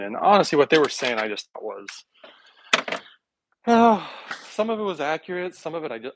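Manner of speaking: ordinary talk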